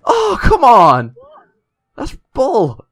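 angry. Real, not acted. Killed unfairly in game.

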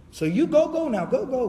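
urging haste. Retold or imitated. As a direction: hurry your brother along. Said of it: "Right now you need to rush".

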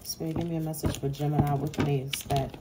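tarot reader quietly asking spirit for a message; soft plea to spirit. Acted. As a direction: ask the spirit gently, almost under the breath. Soft plea to spirit while shuffling.

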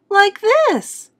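very high mother's voice; demonstrating mother. A narrator's reading. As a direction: show the child how, pitched high.

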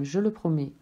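ordinary delivery

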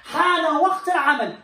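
a slow, high, firm حان وقت العمل; firm announcement. Shown as a demonstration. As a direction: announce it like a teacher. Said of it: "هذا يكفي". (إعلان حازم للطلاب)

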